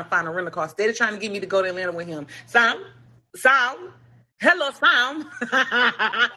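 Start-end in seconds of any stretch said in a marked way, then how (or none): 2.5-5.3 raised, insistent mock hail; imitated calling out. Man hails her at the restaurant, imitated insistent calling. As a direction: call the name higher each time, ending in a laugh. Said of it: "And then I heard him".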